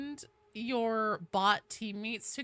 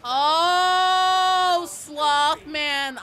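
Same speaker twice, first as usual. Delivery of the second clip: long, loud drawn-out "oh"; high, loud groan of understanding; dawning realization; 7 semitones higher, 12 dB louder, much slower than usual. Real, not acted. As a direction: cry out as it finally clicks. Sudden realisation of viewer's meaning.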